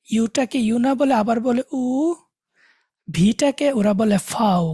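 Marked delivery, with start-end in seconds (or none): 4.3-4.6 "ফাও" starting with an f sound; slow "ফাও", fow with f for V; German letter V. Shown as a demonstration. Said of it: "এফ এর মতো একটা উচ্চারণ হয়".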